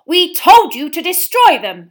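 slow, high, very loud scolding; furious mother shouting, words drawn out. A narrator's reading. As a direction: rage at disobedient children. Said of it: "said Missus Weasley furiously".

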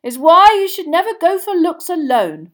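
high, loud shouted lesson; yelling. A narrator's reading. Father warns his boys over the crowd, yelling. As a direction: shout advice over the tumult. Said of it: "yelled Mister Weasley over the tumult".

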